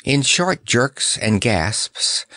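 ordinary delivery, nothing marked

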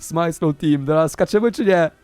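usual speaking voice

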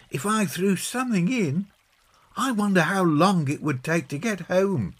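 put-on high-pitched, curious John-style child voice; boy character wondering aloud. John musing to Peggy on the bridge, high child voice.

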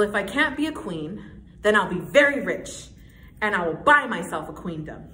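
determined, bossy declaration of a would-be queen; emphatic stage delivery, building in resolve; Lucy. Acted. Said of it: "I'm gonna be playing Lucy".

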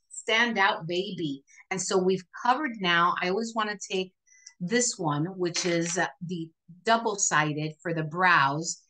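ordinary delivery

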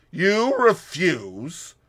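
slow, loud menacing question; Russian man's ugly threat. A narrator's reading. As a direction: let the threat ring out. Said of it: "an ugly ring in the Russian's voice".

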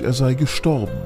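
ordinary delivery

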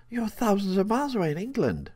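put-on fast, high childlike protest; excited little girl. A narrator's reading. Little girl protests the horse can't be here, excited. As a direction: rush it out like an astonished child.

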